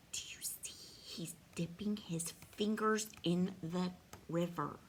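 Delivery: soft, low near-whisper; hushed. Real, not acted. Reader quietly shows child the picture, hushed. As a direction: lean in and ask it quietly.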